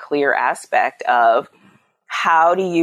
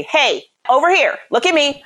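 firm attention-getting call, higher but not yelling; raised voice; 9 semitones higher than usual. Shown as a demonstration. Mother catching her children's attention, controlled raised voice. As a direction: call the kids to attention, pitched up. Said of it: "I raise my voice".

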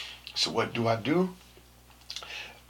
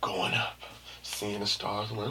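ordinary talk

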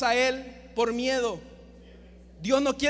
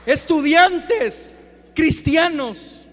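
loud, higher, very slow summons; calling out; 3 semitones higher, 6 dB louder, much slower than usual. Real, not acted. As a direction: call them out, stretching each word. Llama a los estudiantes de la congregación. (llamado fuerte y alargado)

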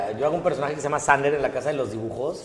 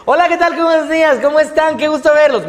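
pad the line to fill time; stretched dub line; 12 semitones higher, 7 dB louder than usual. Shown as a demonstration. Dubber stretching the greeting to fit the actor. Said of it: "Más lento".

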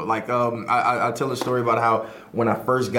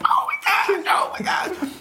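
squealing high oh my God; muted-phone squeal of glee; excited; slower than usual. Retold or imitated. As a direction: squeal it, bursting with joy. Jumping around on a muted phone.

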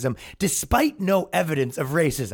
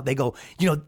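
ordinary talk

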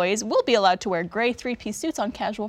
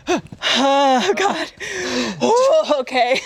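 higher, louder alarmed dad gasps; nervous dads; 5 semitones higher, 6 dB louder, much slower than usual. Retold or imitated. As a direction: play the dads wincing as the baby swings. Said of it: "every single dad on set was like".

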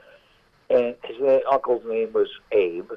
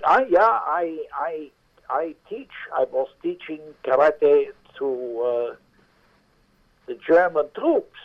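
put-on German accent; 6 semitones higher than usual. Maitre d' quizzing Hank about karate, German accent. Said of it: "with an accent".

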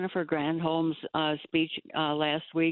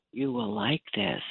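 mock calm voice; 4 semitones lower than usual. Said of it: "They had this calm voice telling you".

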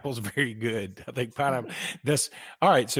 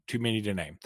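softer aside; 7 dB quieter, faster than usual. Shown as a demonstration. Co-host joking about the sponsors, a softer throwaway repeat. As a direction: repeat "too many to name" much softer, trailing off.

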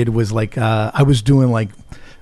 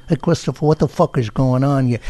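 lightly voiced TV mob boss; a hint of a mob-boss impression; mouth-shaped impersonation, lightly done; James Gandolfini. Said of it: "He does James Gandolfini".

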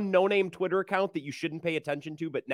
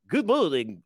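radio host greeting; 3 semitones higher, slower than usual. Retold or imitated. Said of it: "your best good mornings".

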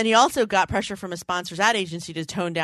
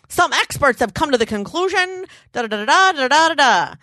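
high, louder newsreel voice; old radio announcer; 7 semitones higher, 4 dB louder than usual. Retold or imitated. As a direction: read it like an old radio analyst. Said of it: "There are those who believe".

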